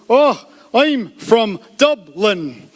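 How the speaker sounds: exaggeratedly slow, word by word; really slow speech. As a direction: leave a gap after every word. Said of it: "just speak really slowly".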